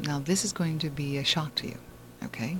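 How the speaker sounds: usual speaking voice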